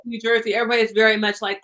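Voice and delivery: normal talking voice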